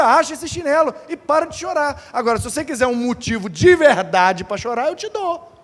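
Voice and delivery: scolding mother. Retold or imitated.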